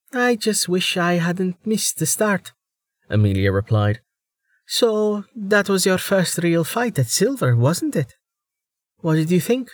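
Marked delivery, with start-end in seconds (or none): 0.1-2.5 higher, light female voice; lightly voiced young woman. Amelia voiced by narrator. 4.7-9.7 higher, light female voice; lightly voiced young woman. Amelia voiced by narrator.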